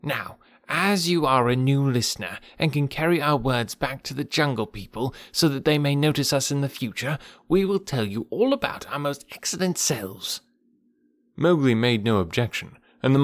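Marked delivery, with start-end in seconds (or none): 0.0-10.4 higher, lightly voiced monkey. A narrator's reading. Monkeys flattering the new listener, lightly higher. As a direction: preen a little as a vain monkey.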